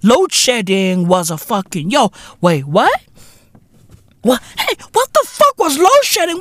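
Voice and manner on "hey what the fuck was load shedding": high, baffled question; mock confusion. Rant to fans, acting baffled by the memory. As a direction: play dumb, pitch way up.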